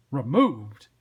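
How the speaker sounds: loud, startled echo; blustering detective boss; exasperated cop Sam. Acted. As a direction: blurt it back in disbelief.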